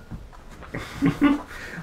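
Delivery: real amused giggle at the ending; high breathless giggle. Real, not acted. Reader enjoying the punchline, light giggle. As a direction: laugh at the twist.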